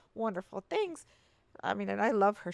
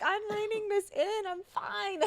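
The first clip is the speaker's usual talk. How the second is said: high, mock-tearful protest; hammed-up wobbly denial, pitched way up; tearful insisting; 12 semitones higher, slower than usual. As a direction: insist through put-on tears. Reenacts her tearful denial in therapy.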